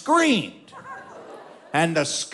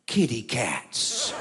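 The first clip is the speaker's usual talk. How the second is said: low, soft, slow and dreamy; innocent drunk; 9 semitones lower, 5 dB quieter, much slower than usual. Retold or imitated. Drunk Wally spots the skunks, low soft slow innocent wonder. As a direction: coo it like a wonder-struck drunk. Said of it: "with all the innocence of a drunk".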